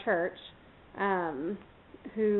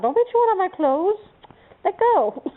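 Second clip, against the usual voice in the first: higher, louder mock-stern scolding; scolding the puppy; 10 semitones higher, 5 dB louder than usual. Real, not acted. Owner scolding her puppy for chewing her clothes, high mock-stern. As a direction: tell the puppy off for chewing, half playful.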